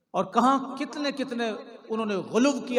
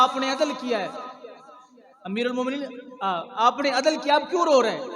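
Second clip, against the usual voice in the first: raised, higher imploring quote; earnest retold pleading; 6 semitones higher, 5 dB louder, faster than usual. Preacher voices Hasan consoling the weeping caliph, earnest pleading. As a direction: plead with him, lifting the pitch and pressing the question.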